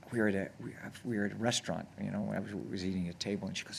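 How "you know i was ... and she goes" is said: usual speaking voice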